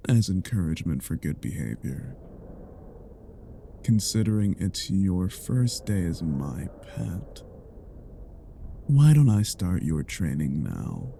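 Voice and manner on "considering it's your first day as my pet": ordinary talk, nothing marked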